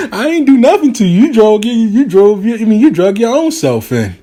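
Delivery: high-pitched mocking denial; laughing taunt. Man teasing his ex by phone, high mocking taunt. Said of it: "he was just laughing, like, the whole time".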